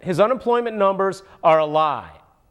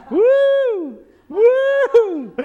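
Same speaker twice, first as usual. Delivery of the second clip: whooping; 4 dB louder than usual. Real, not acted. Excited whooping.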